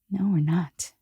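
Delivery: barely voiced, discreet no; hushed refusal. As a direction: say it softly so others won't hear. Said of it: "I went like this".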